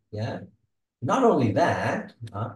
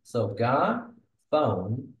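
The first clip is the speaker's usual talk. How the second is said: got and phone said slowly and weighted; teacher showing which words carry stress; stressing content words; much slower than usual. Shown as a demonstration. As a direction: lean hard on got and phone. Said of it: "So that's sentence stress".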